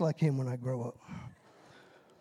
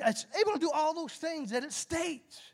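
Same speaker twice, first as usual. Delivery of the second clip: usual speaking voice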